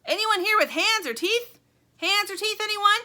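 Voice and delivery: high, blaring call for help; loud high-pitched character yell; bugle-like yell. A narrator's reading. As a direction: keep bugling it out, loud and high. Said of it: "The voice continues".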